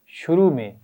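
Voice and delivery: ordinary delivery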